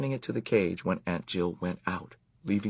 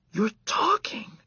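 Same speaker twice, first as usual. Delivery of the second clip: slow, high unvoiced whisper; stunned whisper. A narrator's reading. Boy stunned his mouse can talk, awed whisper. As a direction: barely breathe the words in awe.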